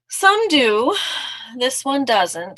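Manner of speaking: emphatic little comeback; defiant mouse. Acted. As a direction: talk back as a cheeky storybook mouse. Mouse retorts to fox.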